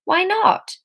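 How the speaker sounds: high, indignant protest. A narrator's reading. Friend pushing back indignantly. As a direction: Emma bristles at the idea. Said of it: "Emma replied indignantly".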